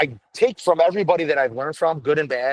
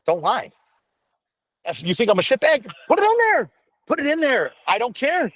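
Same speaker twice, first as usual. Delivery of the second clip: raised voice; 9 semitones higher than usual. Retold or imitated. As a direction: tell them straight to put it in. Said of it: "I go".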